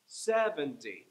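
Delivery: Seventy slow, stepping down syllable by syllable; walk Seventy down a staircase, slowly; slow descending steps. Shown as a demonstration. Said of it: "imagine a descending staircase".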